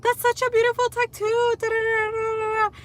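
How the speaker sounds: put-on high, breathy gushing girl voice; mock an over-eager fan, way up high; gushing stranger. Mocking the stranger gushing over her tattoo, high gushing voice. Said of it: "And it's like".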